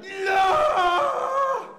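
very high, loud, held roar; hammed-up charging-into-battle yell; warrior queen battle cry. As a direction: imitate a roaring warrior queen. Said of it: "turned into this archetypal Scandinavian warrior queen".

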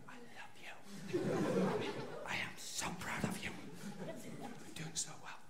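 barely audible, timid whisper; whispered reassurance. Retold or imitated. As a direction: whisper it meekly to someone roaring. Cowed husband after wife's roar.

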